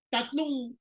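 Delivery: ordinary delivery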